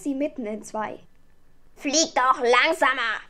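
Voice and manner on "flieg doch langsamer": loud, scolding raven; schimpfender Rabe. A narrator's reading. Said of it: "schimpfte der Rabe". (laut schimpfender Rabe)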